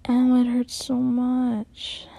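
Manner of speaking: sneering imitation of a crying sub; mocking whine. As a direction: imitate her complaining to belittle her. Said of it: "Listen to you".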